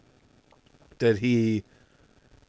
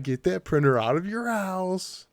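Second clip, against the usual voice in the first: higher-pitched insistent mock warning; emphatic mock warning; 7 semitones higher, 4 dB quieter than usual. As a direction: urge it with the pitch pushed up. Acted warning, raised second take.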